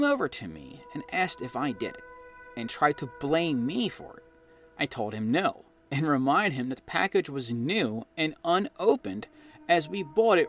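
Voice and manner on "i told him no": ordinary delivery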